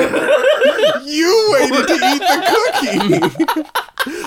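high, slow words through laughter; laughing. Real, not acted. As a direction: laugh out every word. Laughing at the cookie.

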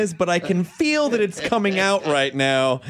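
mock empath. Mock empath sensing racism.

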